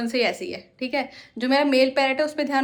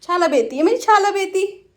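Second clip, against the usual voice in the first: put-on higher, louder sing-song baby talk; cutesy toddler pronunciation; 7 semitones higher, 7 dB louder than usual. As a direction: coo at the bird like a toddler.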